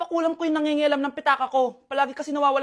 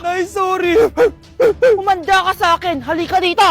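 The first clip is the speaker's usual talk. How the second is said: much louder, angry yelling; furious scolding; 3 semitones higher, 8 dB louder than usual. Acted. Mother yelling at her son who stole her money. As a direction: tear into the thieving son.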